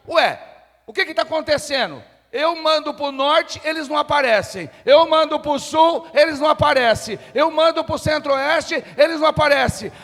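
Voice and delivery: king complaining his armies never show up; frustrated king. Acted. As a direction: play the baffled, annoyed king. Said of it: "o rei ficou contrariado".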